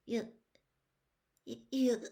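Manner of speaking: hoarse. A narrator's reading. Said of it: "Wormtail's voice suddenly sounded hoarse".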